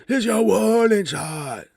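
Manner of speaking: high, loud, slow mock hype promo; mocking hype wrestler. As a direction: ham up a hyped-up promo. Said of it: "he comes out there and he's like".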